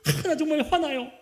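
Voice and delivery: loud, high cry of frustration; a preacher acting out an angry prayer; mock angry outburst at God. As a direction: hurl the complaint up at God. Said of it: "때로는 막 대들기도 해요".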